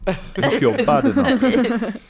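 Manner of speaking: high, slow taunt with a laugh. Retold or imitated. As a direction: taunt them through a laugh.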